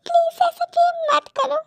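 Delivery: high, wounded begging; hurt pleading. Acted. Hurt woman begs him to stop.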